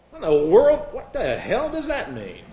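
disbelieving outburst that dissolves into a laugh; can't believe what he just read; incredulous. Real, not acted. Preacher mocks a scholar's claim.

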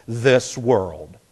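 "this" and "world" weighted equally; equal stress on THIS WORLD. Shown as a demonstration. Preacher shows forced emphasis, "this" and "world" stressed equally. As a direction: land evenly on both "this" and "world". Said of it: "emphasize them both equally".

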